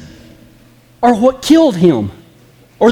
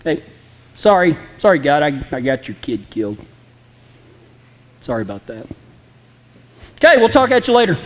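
offhand mock sorry, trailing softer then rushed at the end; flippant apology; 7 semitones lower, 4 dB quieter, faster than usual. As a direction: brush off God with a careless sorry. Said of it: "Or are you flippant about it?".

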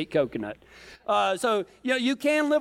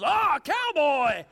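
drawn-out, higher-pitched call at spotting a cowboy; excited onlookers; 5 semitones higher than usual. Retold or imitated. Locals greet the cowboy hats in Jerusalem, excited mimicry. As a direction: imitate gawkers calling out eagerly. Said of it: "everybody goes".

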